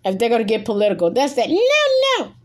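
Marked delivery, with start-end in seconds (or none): 1.5-2.3 high, long-held no-no; teasing drawn-out high warning; playful warning. Real, not acted. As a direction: stretch NO-NO out way up high. Playfully stressing what is forbidden.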